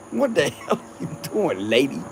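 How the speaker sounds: laughing through the line; amused retelling of his own line; laughing reenactment. Retold or imitated. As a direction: retell it while chuckling. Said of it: "I said that".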